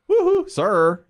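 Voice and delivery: high, loud hoot to hail someone; calling out. Retold or imitated. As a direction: flag a man down with a hoot. Hailing the bag runner.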